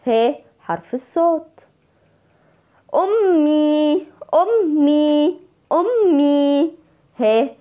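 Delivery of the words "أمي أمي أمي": "أمي" high and slow, ending on a sustained ee; stretched long vowel. Shown as a demonstration. Teacher showing pupils the madd, drawn-out ee in "أمي". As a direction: hold the final ee of "أمي" long and level. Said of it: "مد بالياء".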